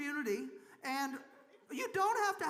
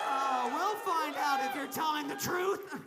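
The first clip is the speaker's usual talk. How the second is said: loud mock threat to the crowd; big playful warning yell; playful shout; 6 dB louder than usual. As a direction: shout it over the cheering, teasing. Teasing a cheering crowd.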